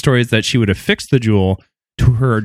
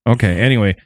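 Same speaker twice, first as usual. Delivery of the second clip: ordinary talk, nothing marked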